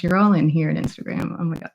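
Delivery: normal talking voice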